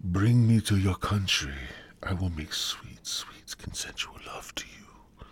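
mock seductive woman, fading to a whisper; playful come-on dropping away to a whisper; imagined Brazilian woman. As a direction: imitate a suitor, sinking into breathy hush. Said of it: "And she's like".

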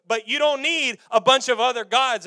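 proclaiming God voice. Acted. God declaring himself to Abraham, loud and rising. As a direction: build it up like thunder.

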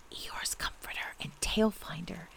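soft, confiding whisper; hushed explanatory gloss; whispered aside. A narrator's reading. Aside spelling out Pooh's titles.

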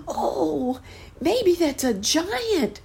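put-on high, slow frightened child's cry; a little boy imagining a giant; high wide-eyed fearful boy; scared boy. A narrator's reading. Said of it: "cried the boy".

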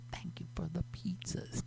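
breathy whispered thanks. Pretend. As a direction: whisper it like a secret.

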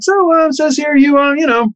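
lightly voiced, high and chatty; a hint of a friendly star quizzing him; imitating Tom Hanks. Tom Hanks voiced reading resume.

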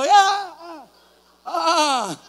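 high mock cheers, fading; cheer, looking for company; cheering yells. Preacher imitating himself cheering alone, high mock yells. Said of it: "yo buscando".

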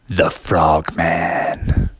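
very low, drawn-out title; deep, slow dramatic reveal; grand deep reading. A narrator's reading. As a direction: intone it like a fight nickname. Reads name on the robe.

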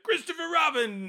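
high excited call of a name; high startled cry of a name. Retold or imitated. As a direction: shout the name in alarm, high. Retold movie scene, calling out.

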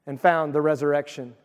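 ordinary delivery

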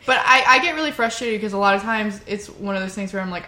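normal talking voice

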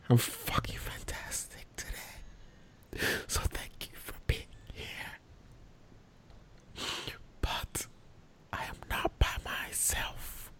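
slow, breathy ASMR whisper. Acted. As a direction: whisper it close to the mic like ASMR. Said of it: "Why are you whispering?".

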